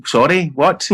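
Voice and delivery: startled "sorry, what?" at the orders; startled disbelief. Retold or imitated. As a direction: retell your own shocked double-take. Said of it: "and I'm like".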